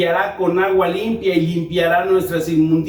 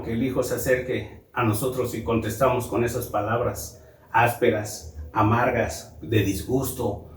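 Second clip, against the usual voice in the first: bajando mucho la voz, más grave; 7 semitones lower, 6 dB quieter than usual. Acted. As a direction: casi murmurado, grave y lento al final. Palabras ásperas a los hijos. (padre que contesta al hijo con palabras ásperas, en voz baja y grave)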